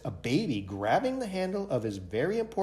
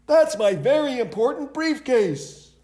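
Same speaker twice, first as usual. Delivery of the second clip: high, louder desperate bellow; 12 semitones higher, 6 dB louder, slower than usual. A narrator's reading. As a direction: keep bellowing after the bus. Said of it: "bellowed Mister Brown".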